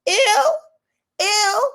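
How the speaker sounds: high, loud squeal of disgust. Real, not acted. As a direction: recoil with a shrill-free loud ew. Disgust at trap house idea.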